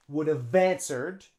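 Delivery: "Would have answered" as "would-uv-vanswered"; linking. Shown as a demonstration. Teacher repeats the link for learners, joined up. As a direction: join "have" onto "answered". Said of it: "we link them".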